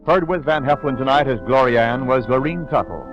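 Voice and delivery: ordinary talk, nothing marked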